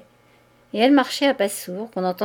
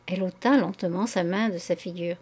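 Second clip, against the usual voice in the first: ordinary delivery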